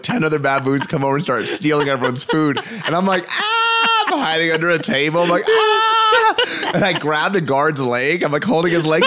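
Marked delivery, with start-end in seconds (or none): 3.3-4.0 high laughing shriek of panic; mock scream. Telling a friend about baboon chaos, a laughing mock shriek. 5.5-6.3 high, loud shriek; mock scream. Hiding under a table from baboons, a high mock shriek.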